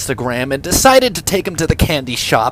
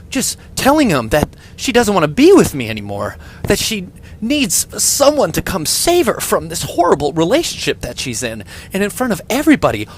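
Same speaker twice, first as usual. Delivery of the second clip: ordinary talk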